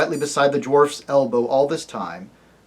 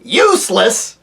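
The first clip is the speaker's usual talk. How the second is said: irritable dwarf; 8 semitones higher, 7 dB louder, slower than usual. Acted. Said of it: "said the dwarf irritably".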